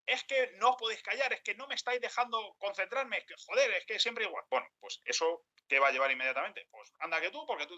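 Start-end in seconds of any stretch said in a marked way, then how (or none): 0.0-4.4 high, exasperated outburst; acted fed-up outburst, much higher; snapping outburst. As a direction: finally lose patience with noisy coworkers. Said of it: "entonces rompo y digo".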